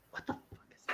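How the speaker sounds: barely audible, breathed-out mutter; muttered to self. Real, not acted. Host muttering in frustration while searching for the show.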